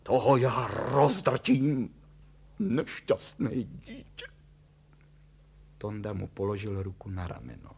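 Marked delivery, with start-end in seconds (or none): 0.0-4.3 loud, slow, menacing threat; combative. A narrator's reading. Father vowing to crush the scoundrel, combative. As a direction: grind the words out loudly. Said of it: "Děl bojovně, mávaje pěstmi".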